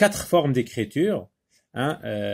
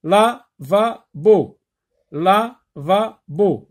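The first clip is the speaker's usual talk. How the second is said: "Lavabo" slow and higher, la, va, bo; split into syllables; 4 semitones higher, 5 dB louder, much slower than usual. Shown as a demonstration. Teacher asking where L is heard, split into syllables. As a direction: give each syllable of "lavabo" its own separate beat. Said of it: "Dans la première syllabe".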